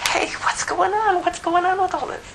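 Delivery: very high, pinched demand; squeaky questioning son. Acted. Elder son asking a servant what the party is.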